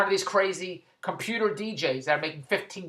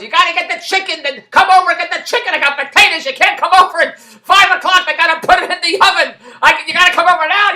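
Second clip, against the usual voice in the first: much higher, louder mock outrage; exasperated protest; 9 semitones higher, 11 dB louder than usual. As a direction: squawk that five is too late. Mother of the kids demanding by phone.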